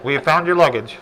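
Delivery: loud PA announcer voice; airport announcement. Pretend. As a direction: announce it over the terminal speakers. Repeats mock airport announcement.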